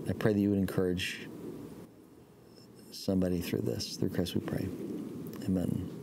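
hushed pastor's prayer. Acted. Opening prayer before sermon.

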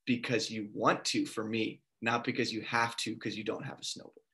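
low, soft, measured words; inner voice of God. Retold or imitated. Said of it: "I have this thought".

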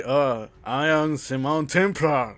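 slow, heavy Austrian accent, playing Arnold; mock Arnold Schwarzenegger in a thick Austrian accent; Austrian-accented Arnold voice, drawn out. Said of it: "con su buen acento austriaco".

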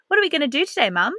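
put-on high, eager child asking his mum; excited little boy. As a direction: lightly play the boy, bright and keen. Mother voices her young son.

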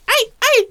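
very high, loud imitation shrieks; mock crazed yelps. Critic mimicking a commentator going crazy, shrieking impression. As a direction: yelp it like a commentator losing it. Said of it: "when he goes crazy and he's like".

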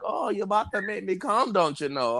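put-on a rough try at a Scottish woman's accent; a half-hearted Scottish accent; attempted Scottish accent. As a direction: lightly mimic the Scottish woman. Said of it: "I can't do the accent".

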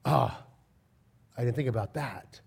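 oh-you've-got-me, said low; humble concession. Retold or imitated. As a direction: imitate someone humbly conceding a point. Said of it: "they're humble enough going".

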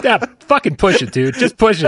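loud, egging him on; high, loud goading cheer; excited urging. Real, not acted. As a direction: shout it like cheering from the stands. Urging the villain comment on.

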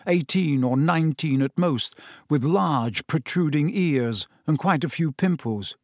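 ordinary delivery

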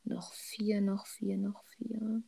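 whispered to herself, repeating; whispering. Real, not acted. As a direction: mutter it under your breath. Counting under breath, thinking.

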